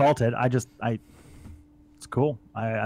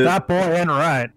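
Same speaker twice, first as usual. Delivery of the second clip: Texan drawl; 3 semitones higher, 4 dB louder, much slower than usual. Pretend. Quoting King of the Hill.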